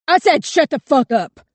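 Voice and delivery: screaming at the top of the voice; enraged gunman screaming at the girls; furious scream. A narrator's reading. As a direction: scream it in rage, high and loud. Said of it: "Blackshirt screamed".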